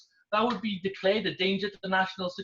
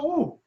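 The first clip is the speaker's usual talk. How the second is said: mock ooh; 8 semitones higher than usual. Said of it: "And then they'll go".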